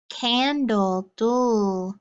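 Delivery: "candle" then its ending "dul" alone, drawn out; sound card drill. Shown as a demonstration. Teacher drilling sound cards with the class, ending isolated. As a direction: say "candle", then hold the last syllable "dul". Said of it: "get ready for our sound cards".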